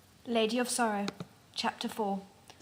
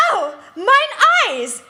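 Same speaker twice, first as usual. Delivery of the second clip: much higher, much louder hammed-up scream; 10 semitones higher, 10 dB louder, slower than usual. A narrator's reading. Bella hit by lasers in her face, hammed-up shriek. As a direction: shriek as if your face just got scalded. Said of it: "she screamed excitedly".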